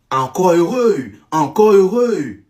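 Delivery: slow, insistent exclamation; emphatic relief. Real, not acted. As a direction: stretch each word out, pushing harder on the repeat. Emphatic approval, repeated for effect.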